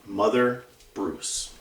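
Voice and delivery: usual speaking voice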